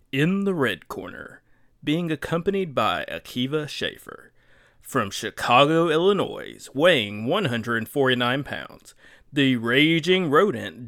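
booming boxing ring announcer hype. Acted. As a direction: announce the fighter like a prizefight. Boxing intro of the red corner.

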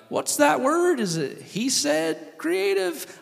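high, drawn-out Southern drawl; mock Southern accent. Preacher mimicking people confused by a Hebrew name, mock Southern accent. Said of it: "I do a Southern accent".

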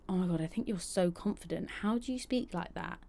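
soft whisper, breathless admiration. Retold or imitated. Fans messaging her, imitated in an admiring whisper. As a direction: whisper it like a starstruck fan. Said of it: "some people message me afterwards and say".

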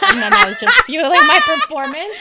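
words spilling out through a laugh. Real, not acted. Joking to her co-host about needing applause, laughing. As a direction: laugh at yourself while you say it.

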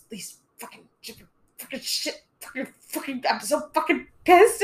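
hushed cursing that bursts loud; mock angry swearing. Teacher alone in planning period, cursing in mock fury. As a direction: vent under the breath then blow up. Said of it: "I'll just sit there and I'll go".

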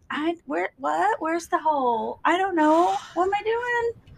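high, lost and baffled mock voice; confused golfer. Imitating a lost beginner golfer, high and baffled. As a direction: play a clueless newbie on the green. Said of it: "I'd be like".

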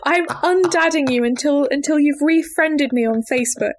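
hammed-up outrage; mock indignant dad. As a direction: declare it like an offended father. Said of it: "he stomped downstairs and says".

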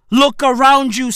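usual speaking voice

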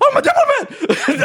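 very high, drawn-out mock scream; hammed-up high-pitched shriek; retold scream. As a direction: scream it in comic panic, laughter breaking in. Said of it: "I start screaming".

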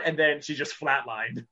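ordinary talk, nothing marked

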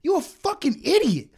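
loud, high, furious rant; losing it. Retold or imitated. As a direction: shout him down, pitch climbing as you go. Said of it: "I lost it".

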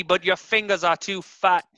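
exaggerated Australian bully jibe; mock Australian accent. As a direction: jeer in put-on Australian. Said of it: "still talking shit".

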